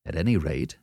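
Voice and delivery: ordinary delivery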